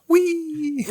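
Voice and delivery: high, laughing whoop of joy; gleeful shout. Retold or imitated. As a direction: whoop it like a thrill ride. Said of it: "he shouts".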